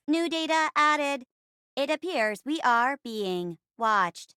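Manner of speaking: measured, synthetic robot delivery; navigator robot. Acted. Robot in a space play reporting, mechanical voice. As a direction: report it like a ship's computer. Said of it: "playing the role of the navigator robot".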